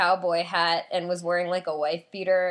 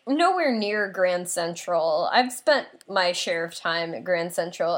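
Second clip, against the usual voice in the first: normal talking voice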